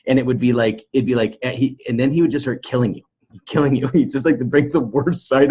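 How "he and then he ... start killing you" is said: normal talking voice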